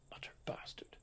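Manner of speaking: usual speaking voice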